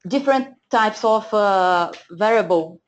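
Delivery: normal talking voice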